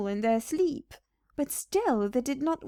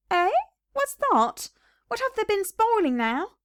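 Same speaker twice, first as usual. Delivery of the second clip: put-on much higher, sharp questioning old wife; Mrs McGregor inquiring; 10 semitones higher than usual. Wife questioning her husband about the rabbits, high and suspicious. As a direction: squeak up in suspicion. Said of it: "inquired Missus McGregor".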